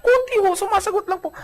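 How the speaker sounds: high, meek, sheepish admission. Retold or imitated. A wife to her pastor, sheepish and high. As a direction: play small and innocent.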